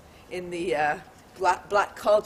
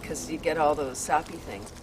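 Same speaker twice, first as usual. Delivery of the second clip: ordinary talk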